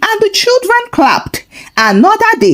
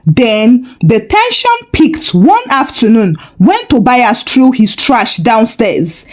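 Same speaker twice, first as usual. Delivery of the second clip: ordinary delivery, nothing marked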